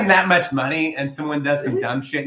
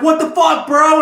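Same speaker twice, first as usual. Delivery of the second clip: very high, loud imitated onlookers' shout; mock outcry; 8 dB louder, slower than usual. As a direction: shout it as the shocked crowd. Acting out shocked onlookers.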